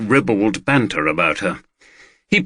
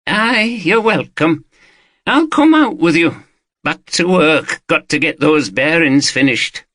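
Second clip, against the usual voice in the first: a hint of a higher, chattier local man; lightly voiced Watson; 7 semitones higher than usual. Watson telling the detective about the concert, lightly voiced. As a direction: pitch him a little up, easygoing.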